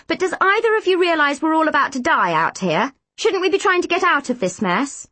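high, agitated interruption; bursting in. A narrator's reading. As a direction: break in with urgent indignation. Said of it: "Mandy burst in".

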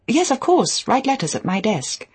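high, lilting hostess voice; imagined hostess. A narrator's reading. Hostess telling her guest to use the desk, lightly voiced. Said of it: "my hostess had said to me".

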